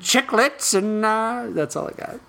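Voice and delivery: lightly voiced old gold prospector; a hint of a grizzled miner. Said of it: "You sound like a prospector towards the end".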